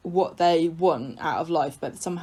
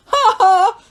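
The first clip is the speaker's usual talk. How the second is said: high, loud fake gloating laugh; mocking "ha ha"; 9 dB louder than usual. Co-host mimicking Ken and Peter jeering at Tracy, mock gloating laugh. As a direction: imitate two men crowing in triumph. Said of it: "Ken and Peter are like".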